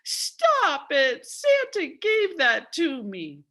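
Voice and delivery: put-on high, loud child's protest; crying out. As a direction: cry out to make the boys stop. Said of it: "while I cried".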